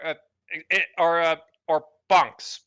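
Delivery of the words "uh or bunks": ordinary delivery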